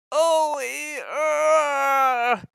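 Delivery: high, strained groan. A narrator's reading. Percy groans after crashing into the luggage. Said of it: "groaned Percy".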